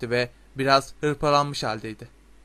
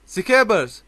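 loud, high cry of the name; shouting; 7 semitones higher, 7 dB louder than usual. A narrator's reading. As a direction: yell after a runaway pet. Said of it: "diye bağırdı Ron".

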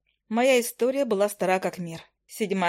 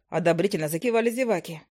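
ordinary delivery

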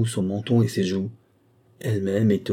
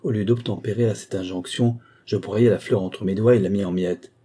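ordinary delivery, nothing marked

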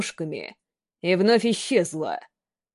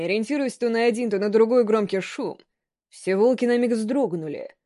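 ordinary delivery

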